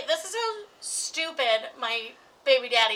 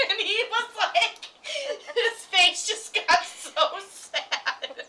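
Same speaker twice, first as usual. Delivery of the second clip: high, slow, helpless laughing; cracking up while telling it; laughing through words; 7 semitones higher, 4 dB louder, much slower than usual. Real, not acted. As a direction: drag it out through real laughter. Cracking up at own story.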